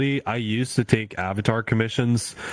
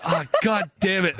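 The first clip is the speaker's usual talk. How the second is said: high-pitched comic exasperation; grudging, hammed-up annoyance; mock fury; 9 semitones higher than usual. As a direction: curse in retold frustration, pitched high. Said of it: "I'm furious".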